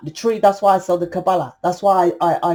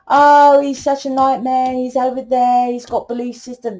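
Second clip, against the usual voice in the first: mock complaining; 5 semitones higher than usual. Woman mimicking someone who blames their partner, mock moaning. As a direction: imitate someone moaning on, pitched up.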